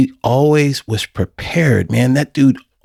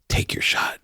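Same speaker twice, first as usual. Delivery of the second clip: whispered pep talk; 8 dB quieter than usual. Retold or imitated. Urging himself on the plane.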